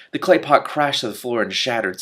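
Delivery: ordinary delivery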